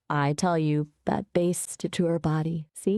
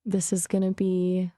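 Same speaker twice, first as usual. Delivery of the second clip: ordinary talk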